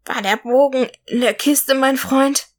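weak eagle croaking up to its friend. A narrator's reading. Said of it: "krächzte er".

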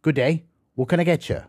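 quick, run-together Aussie coffee shop patter; fast Australian greeting. Shown as a demonstration. Coffee shop worker greeting a customer, fast Australian style. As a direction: rattle it off fast like an Australian server. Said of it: "the Australians speak pretty fast".

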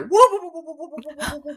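very high whooping skeleton cry. Acted. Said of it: "And skeleton voices".

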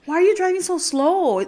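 high-pitched mock exasperation; acted-out road gripe, pitched well up; retold driver's yelling. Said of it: "yelling at".

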